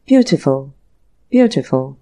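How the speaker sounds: low, perfunctory praise. A narrator's reading. Desperate customer putting down a goblet forced on him, perfunctory. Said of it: "he exclaimed in a perfunctory manner".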